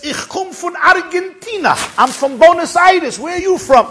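high, loud, animated voice; exuberant old man. Retold or imitated. Old man quizzing the visitor, high and exuberant. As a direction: introduce yourself with booming eagerness.

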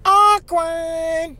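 high, drawn-out comic aside; high singsong signal of discomfort; singsong "awkward". Acted. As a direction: stretch the word out on a high held note. Comic inner reaction to photo request.

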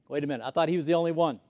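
ordinary talk

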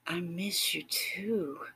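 slow, breathy near-whisper, pitch lifted a little; gentle and sweet. A narrator's reading. Partner reassuring a lonely lover, gentle and sweet. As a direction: answer tenderly, drawing the words out. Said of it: "so gentle and sweet".

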